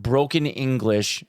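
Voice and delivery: ordinary delivery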